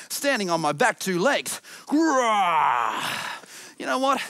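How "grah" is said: pretend bear roar. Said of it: "I'm a really tall polar bear".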